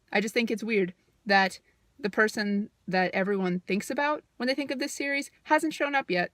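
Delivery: normal talking voice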